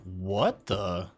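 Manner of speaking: mock dumbfounded, drawn-out question rising at the end; stunned disbelief. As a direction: imitate someone whose brain just stalled. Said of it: "shut down".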